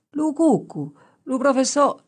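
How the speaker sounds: high-pitched Gallurese shepherd; shepherd's dialect answer. A narrator's reading. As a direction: pipe up high as a country pupil answering the teacher. Shepherd's eager dialect answer voiced.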